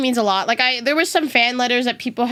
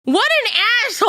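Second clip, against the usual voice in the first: much higher, hammed-up indignant rant at the thief; mock outrage; 9 semitones higher than usual. Streamer retelling the car break-in to chat, hammed-up outrage. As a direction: play up mock fury over a stolen trash can, much higher. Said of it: "the thing I was crashing out about".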